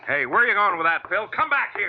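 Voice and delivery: loud call after a man leaving the room; calling after him. Acted. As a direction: shout to stop him at the door. Partner runs off with evidence.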